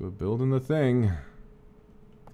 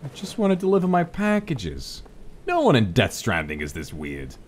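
ordinary talk, nothing marked

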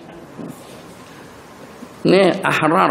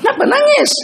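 high, loud, worried why; worried question; 3 dB louder than usual. Acted. Family asking the crying girl why she weeps, high and alarmed.

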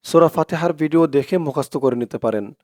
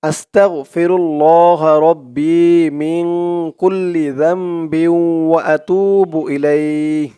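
slow, drawn-out chanted Arabic recitation; much slower than usual. Shown as a demonstration. Teacher reciting a dua for viewers, slow devout chant. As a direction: intone each word long and devout.

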